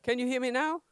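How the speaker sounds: loud, projecting to the room; outdoor voice. Real, not acted. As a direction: call it out to the back row. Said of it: "Let me use my outdoor voice".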